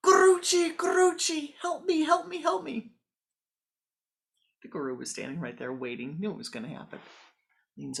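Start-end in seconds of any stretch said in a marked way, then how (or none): 0.1-2.9 very high, very loud cries for help; terrified screaming. Acted. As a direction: scream for the guru in terror. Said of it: "the man yells out".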